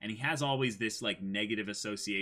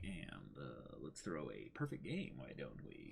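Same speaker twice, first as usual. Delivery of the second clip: smug casual murmur; 9 semitones lower, 14 dB quieter, slower than usual. Retold or imitated. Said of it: "the Beast Titan is basically just like".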